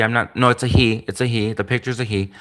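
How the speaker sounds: ordinary talk, nothing marked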